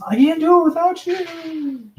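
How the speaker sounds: high pleading voice; mock plea. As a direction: beg dramatically. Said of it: "that's what I'm thinking".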